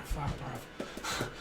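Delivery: breathy whispered swear under the breath; whispered curse. Real, not acted. Losing her place in the reading, she whispers a curse to herself. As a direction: let it slip out as a whisper. Frustrated aside while reading cards.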